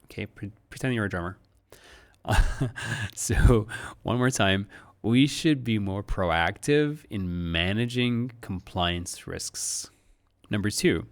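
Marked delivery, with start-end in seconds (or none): 5.0-9.9 slow, beat-like, louder read; drumming rhythm. Shown as a demonstration. Teacher reading slowly and rhythmically, like a drummer. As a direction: tap out each word like a drum. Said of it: "like you're playing drums".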